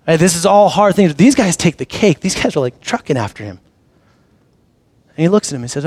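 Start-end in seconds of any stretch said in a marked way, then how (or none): none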